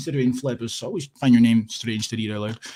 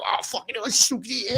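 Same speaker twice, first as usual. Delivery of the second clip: much higher hammed-up delight; mock fan; 10 semitones higher than usual. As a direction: fake gushing about a rally.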